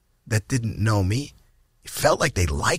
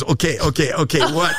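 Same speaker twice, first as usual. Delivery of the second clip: higher, nervous repeated okays; mock panic; 6 semitones higher than usual. Comic reliving his first stage set, in higher mock panic. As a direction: act out a frozen young comic stalling. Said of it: "My act was".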